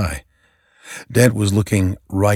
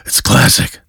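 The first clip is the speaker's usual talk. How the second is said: snarled; 5 semitones higher, 5 dB louder than usual. Acted. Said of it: "snarled Batman".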